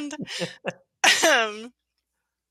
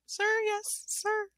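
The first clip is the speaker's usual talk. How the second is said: softer, hesitant reply; unsure, asking rather than answering; questioning; 6 semitones higher, 9 dB quieter, slower than usual. Acted. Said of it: "like, questioning".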